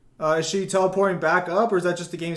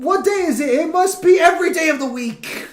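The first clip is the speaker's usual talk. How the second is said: sarcastic teasing; 10 semitones higher, 5 dB louder than usual. Real, not acted. Continues teasing friend.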